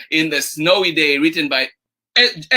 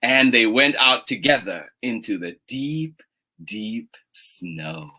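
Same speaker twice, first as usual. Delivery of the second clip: gentle, drawn-out story reading; picture-book narration; 4 semitones lower, slower than usual. A narrator's reading. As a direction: read slowly to little children, stretching key words. Reads picture book aloud.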